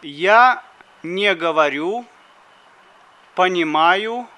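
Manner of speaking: ordinary delivery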